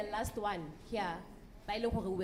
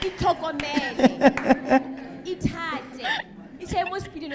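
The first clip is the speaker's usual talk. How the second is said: laughing; 5 semitones higher, 12 dB louder than usual. Real, not acted. Laughing loud advice.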